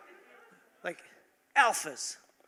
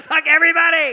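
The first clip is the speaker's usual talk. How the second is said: laughing shout; 9 semitones higher, 9 dB louder than usual. Pretend. Mock defiant Oscar speech.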